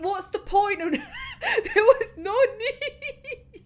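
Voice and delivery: high, drawn-out wail through laughter; laughing exasperation. Real, not acted. Podcaster complaining about pointless decoys, laughing in exasperation. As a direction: laugh helplessly while protesting.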